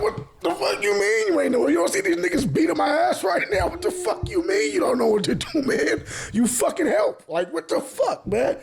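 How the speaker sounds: high, furious rant at the friend; outraged yelling with a laugh breaking through; angry yelling. Retold or imitated. Yelling at friend who froze.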